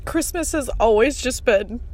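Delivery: crying while talking. Real, not acted. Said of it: "I'm crying".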